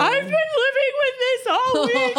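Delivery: mock tearful wail, high-pitched; imitated sobbing outburst through laughter; bursting into tears. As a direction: wail it like you've hit breaking point. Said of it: "burst into tears".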